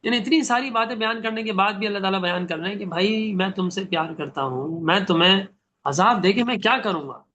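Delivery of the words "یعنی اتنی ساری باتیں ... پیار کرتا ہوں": normal talking voice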